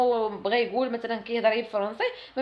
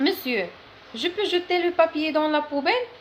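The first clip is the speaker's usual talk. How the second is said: higher, slow polite request; polite pupil question; 5 semitones higher, 3 dB louder, much slower than usual. Shown as a demonstration. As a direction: ask the teacher sweetly and slowly. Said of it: "model phrase for students". (سؤال مؤدب بصوت أعلى وببطء)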